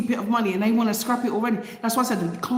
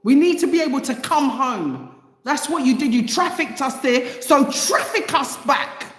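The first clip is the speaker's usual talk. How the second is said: heated demand with raised pitch; fired-up, higher-voiced angry protest; real anger; 5 semitones higher than usual. Real, not acted. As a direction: build an angry, insistent case. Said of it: "getting angry a lot this week".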